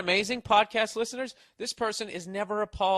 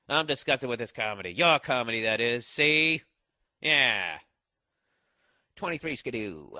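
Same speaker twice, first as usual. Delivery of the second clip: lower, hardboiled noir delivery; film noir voice; 5 semitones lower than usual. Retold or imitated. Comic mocking fan email as film noir voice. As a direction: play it like a forties noir detective. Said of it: "she starts speaking like in film noir".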